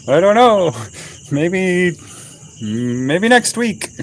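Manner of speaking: high, drawn-out mock hesitation; evasive stalling. Putting off missionaries who want to visit, mock-hesitant. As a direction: stretch it out as you playfully put them off.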